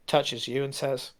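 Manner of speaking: normal talking voice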